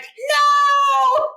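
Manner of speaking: very high, loud drawn-out cry; comic high-pitched retold shout; panicked shout. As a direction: wail it in mock horror. Acts out her panicked inner reaction.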